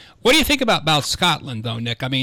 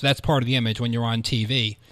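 ordinary talk

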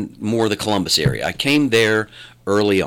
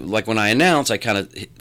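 ordinary delivery

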